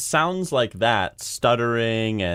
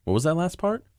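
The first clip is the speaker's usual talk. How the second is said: rising question; 8 semitones higher than usual. Shown as a demonstration.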